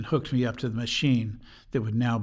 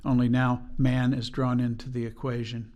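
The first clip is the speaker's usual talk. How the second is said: ordinary talk, nothing marked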